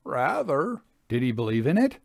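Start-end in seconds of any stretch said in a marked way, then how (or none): 0.0-0.8 laconic reply. A narrator's reading. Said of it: "He replied laconically".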